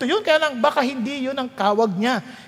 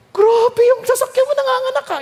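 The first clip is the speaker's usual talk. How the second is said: Grabe bursts out much higher and louder; astonished exclaim; 11 semitones higher, 4 dB louder than usual. Retold or imitated. Joking friend marvels that his cars multiply, astonished. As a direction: marvel out loud in mock awe.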